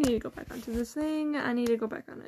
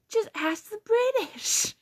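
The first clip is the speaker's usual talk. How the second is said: mock teacher; 9 semitones higher, slower than usual. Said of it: "He also said".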